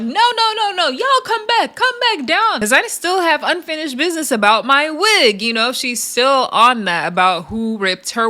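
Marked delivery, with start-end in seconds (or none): 0.0-5.4 high, pleading mock call; mock Big Lex. Big Lex calling the girls back about her wig, high mock plea. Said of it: "Big Lex is trying to stop them".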